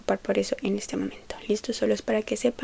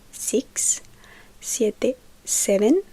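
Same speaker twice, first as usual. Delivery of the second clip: usual speaking voice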